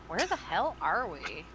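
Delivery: lightly voiced Paris Hilton, slow; Paris Hilton impression. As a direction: drawl it like a socialite. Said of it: "To quote Paris Hilton".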